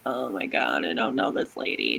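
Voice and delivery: very low, creaky aside; mock husband voice. Husband disowning his labouring wife, jokey low mutter. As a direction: drop low as the appalled husband.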